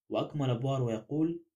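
usual speaking voice

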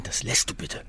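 usual speaking voice

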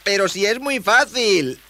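put-on high, drawn-out boyish exclamation; eager high young voice; exclaiming. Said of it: "exclamó Palitroque".

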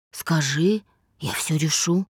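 understated, assured offer; confident. A narrator's reading. Man offers to fix Dasha's problems, confident. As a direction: promise it with easy assurance. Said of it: "уверенно добавил".

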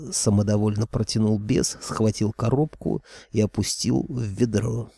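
usual speaking voice

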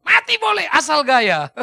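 loud, drawn-out proclamation; swaggering local motto. Acted. Preacher mimicking Siantar folk's motto for laughs, loud swagger. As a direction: belt it out slowly with a laugh.

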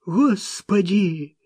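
high, slow terrified cry; frightened cry. A narrator's reading. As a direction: cry out in fear. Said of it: "в страхе крикнул Маккен".

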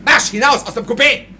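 officer chases his orderly into the corridor; angry order. Acted. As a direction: throw him out of the compartment. Officer throws servant out.

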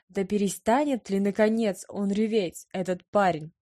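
ordinary delivery, nothing marked

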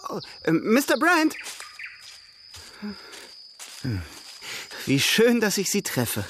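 high, effusive hello; gushing greeting. Acted. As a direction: gush over him with delight.

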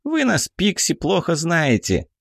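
high, cocky pixie voice; a tiny fairy talking back. Acted. Pixie retorts.

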